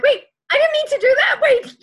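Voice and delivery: high, loud, fast flustered cry; startled self-correction, high and loud; panicked wait. Retold or imitated. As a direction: blurt it out in sudden alarm. Panicked self-quote, sudden realization.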